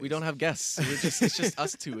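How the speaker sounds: laughing through the words; giggling while saying it. Real, not acted.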